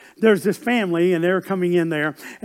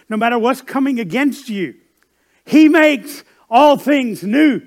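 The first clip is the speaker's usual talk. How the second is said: building sermon; 5 semitones higher, 6 dB louder than usual. Acted. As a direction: preach it up to a triumphant close. Preacher builds to proclamation.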